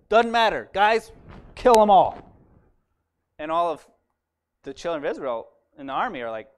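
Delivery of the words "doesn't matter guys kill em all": louder, cold order to kill; ruthless command. Acted. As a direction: bark the order with no mercy. King orders the priests killed.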